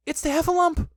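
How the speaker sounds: high, loud nervous alarm; nervous Piglet. A narrator's reading. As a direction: squeak it out in a fright. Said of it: "thought Piglet nervously".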